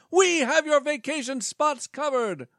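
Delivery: high, upbeat pitchman voice; salesman voice. Acted. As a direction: pitch it like a cheesy presenter. Presenter pitch.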